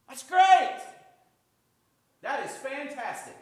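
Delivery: high-pitched burst of delight; excited praise. Acted. Pastor cheering a smoker down to one cigarette, excited praise.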